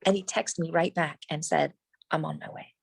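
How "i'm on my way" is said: whisper. Retold or imitated. Retold text, whispered.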